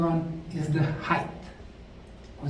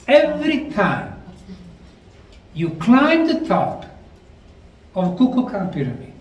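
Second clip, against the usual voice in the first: higher and louder, rising to the point; eager lecturer hitting the climax; excited build; 3 semitones higher, 5 dB louder than usual. Real, not acted. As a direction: lift it up as the reveal lands. Building to the big reveal.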